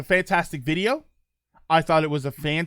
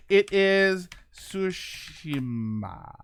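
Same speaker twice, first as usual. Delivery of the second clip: Tsushima very slow, stretched syllable by syllable; exaggeratedly slow, careful Tsushima; drawn-out pronunciation; much slower than usual. Shown as a demonstration. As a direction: sound out Tsushima with long, deliberate vowels. Mock-careful sounding out of name.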